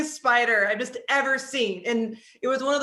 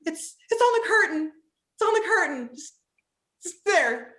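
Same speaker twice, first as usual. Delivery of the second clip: anxious pointing; 7 semitones higher than usual. Retold or imitated. Frightened wife pointing out spider.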